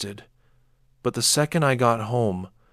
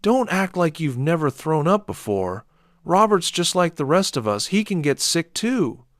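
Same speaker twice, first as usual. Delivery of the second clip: put-on raised, sharp scolding of the boy; angry scolding; 7 semitones higher, 3 dB louder than usual. A narrator's reading. Father scolding his son for crying. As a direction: keep shouting him down. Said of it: "I shouted".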